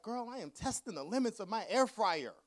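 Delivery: exasperated rant. Retold or imitated. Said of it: "And I was like".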